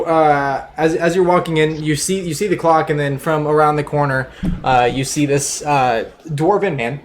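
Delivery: usual speaking voice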